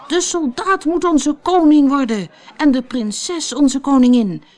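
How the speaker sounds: rally the people behind him; crowd calling. A narrator's reading. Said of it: "begonnen de mensen te roepen". (luid en hoog roepend)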